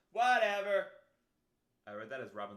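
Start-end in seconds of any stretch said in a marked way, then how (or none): 0.1-0.9 high, loud, over-the-top dismissal; exaggerated whatever. Repeats whatever, escalating loudly.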